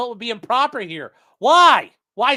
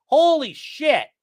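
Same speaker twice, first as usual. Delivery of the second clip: normal talking voice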